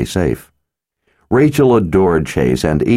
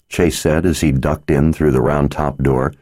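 ordinary delivery

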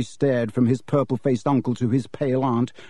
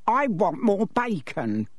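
put-on higher, slow whining demand of a spoilt boy; whiny spoilt boy; 8 semitones higher, slower than usual. A narrator's reading. Spoilt son whining at breakfast for more bacon. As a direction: drag it out like a greedy child.

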